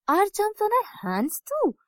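put-on high, wondering child's question; curious little boy. Little brother asks his sister, high curious question. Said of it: "asked Rohan".

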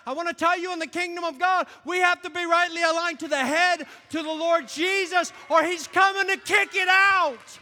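loud, high shouted sermon; fiery preacher shouting the point; full-force preaching. Real, not acted. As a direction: preach it hard to the room.

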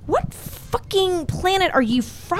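high, slow disbelieving jab; incredulous retort. Retold or imitated. Replying to his message a year later.

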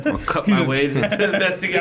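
talking through real laughter; laughing. Real, not acted. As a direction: crack up at your own joke while talking. Player laughing at own joke.

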